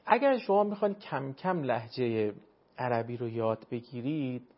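ordinary talk

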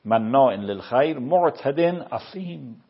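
slow, loud Quranic recitation of مناع للخیر with a stretched مناع. Shown as a demonstration. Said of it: "قرآن میگه".

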